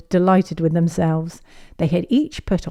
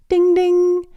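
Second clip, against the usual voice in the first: high ringing ding ding; bell chime; 13 semitones higher, 5 dB louder than usual. Acted. Narrator imitating the playground bell, high and ringing. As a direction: sing out like a school bell.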